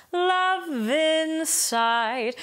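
"love in" run together, sung slow; sung line with connected words; elided lyrics. Shown as a demonstration. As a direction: blend the words into each other. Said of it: "elide lyrics from their onsets and offsets".

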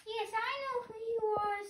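high, small chick voice, lost and asking; a baby bird piping for its mother. Acted. Hatchling searching for its mother, high piping voice. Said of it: "my baby bird voice".

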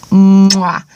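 loud smacking kiss sound; blown kiss. Real, not acted. Vlogger blowing a kiss goodbye to viewers. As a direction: blow a kiss into the mic.

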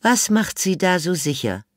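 high, put-on elderly lady, dry; old lady Miss Sophie. A narrator's reading. Old Miss Sophie answers the inspector, high and dry. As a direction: play the haughty old lady. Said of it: "unterbrach ihn Miss Sophie".

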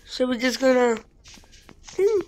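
ordinary talk

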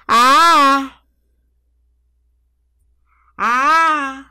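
long, high hammed-up wail; badly faked sob, held loud and high; mock crying. Shown as a demonstration. Showing off his acting cry, loud drawn-out fake wail. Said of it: "eu tenho um choro também, que é assim".

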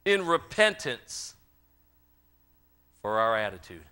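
loud, high preaching that shouts out the regrets; full-force preaching. Acted. Preacher confessing to his congregation, shouting at full force. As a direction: preach it hard, close to a shout.

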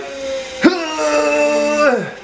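strained heave noise, pushed high; mock macho straining heave; manly effort grunt. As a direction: grunt like lifting something heavy. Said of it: "I did a bit of a manly move and was like".

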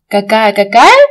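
ordinary talk, nothing marked

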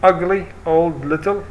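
careful teacher reading out choices; slow option read. Shown as a demonstration. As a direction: list the adjectives very slowly. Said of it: "عندي ثلاث اختيارات".

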